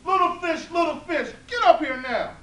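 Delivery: very loud, high bossy call; loud high shouted summons; demanding call. Acted. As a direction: order the fish up now. Old woman summons fish demandingly.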